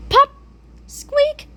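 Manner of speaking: usual speaking voice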